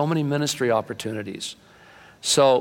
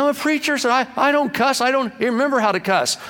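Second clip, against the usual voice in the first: indignant preacher; 12 semitones higher than usual. Retold or imitated. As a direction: squeak out a prim protest. Pastor protests he never cusses.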